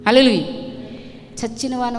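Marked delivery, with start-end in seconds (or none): 0.1-0.4 loud praise shout; loud hallelujah. Real, not acted. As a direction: call it out to the congregation. Preacher exclaims hallelujah.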